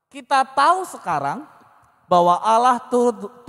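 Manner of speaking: preacher reading the Romans verse aloud; scripture reading. Acted. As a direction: declaim the verse to the church.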